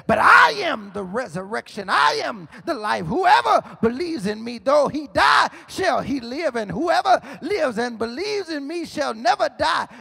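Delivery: loud, high preaching shout; full-force preacher's proclamation. Acted. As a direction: thunder it out from the pulpit. Preaching Jesus' words to Martha.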